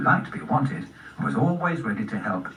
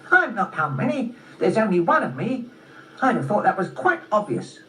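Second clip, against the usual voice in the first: high-pitched, scornful squirrel voice; exasperated little squirrel, pitched high; mocking squirrel; 8 semitones higher than usual. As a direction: state the obvious with squirrel impatience. Squirrel mocks Spot's question.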